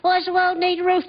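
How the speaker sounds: put-on high, curious child's voice; child's question. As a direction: ask it like a small kid. Child voiced in parent scene.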